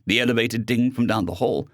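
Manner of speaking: ordinary delivery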